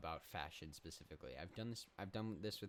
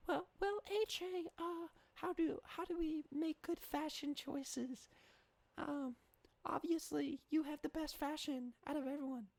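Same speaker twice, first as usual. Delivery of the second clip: put-on very high squeaky kid voice; small child voice. Comic voicing a small child in the audience, very high and squeaky. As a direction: play a little kid asking a question. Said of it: "why, thank you, small child".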